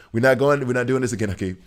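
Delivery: loud exasperated scolding, not again; mock scolding. Scolding a chatter who took Luka over Wemby, loud and fed up. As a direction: shut the argument down hard.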